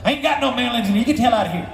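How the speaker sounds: put-on high-pitched cantankerous old-timer. Musician ordering the delivery man away, cantankerous. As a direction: send him packing in a high caricature. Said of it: "[name], is that you?".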